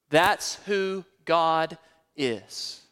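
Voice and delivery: ordinary talk, nothing marked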